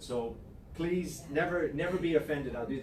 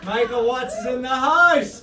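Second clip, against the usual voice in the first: loud, high, drawn-out announcer shout; hyped introduction; 5 semitones higher, 9 dB louder than usual. Real, not acted. Coach announces a guest to the crowd, hyped shout. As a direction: hype up the room for a guest's arrival.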